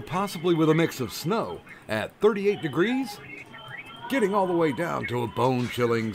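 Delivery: lively TV weatherman read with punched day names; sing-song forecast delivery; mock weather report. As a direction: deliver the forecast like a local broadcaster handing off to sports.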